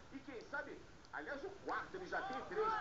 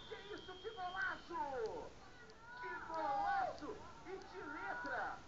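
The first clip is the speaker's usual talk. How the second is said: drawn-out, higher goal exclamation; explosive goal commentary; 6 semitones higher, slower than usual. Real, not acted. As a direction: savor the golaço slowly. Commentator reacts to great goal.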